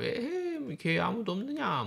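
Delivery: put-on high, drawn-out call of an old yangban master; lordly nobleman's call. Lecturer imitates a nobleman calling his servants, high mock lordly call. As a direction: play the lord summoning his servants from the pavilion. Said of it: "뭐 이렇게 한 거지".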